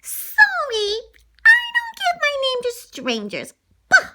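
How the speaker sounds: high, loud, snooty brush-off; haughty refusal. Pretend. Lady snubbing Tramp, high and snooty. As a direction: snub the stranger. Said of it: "and I said".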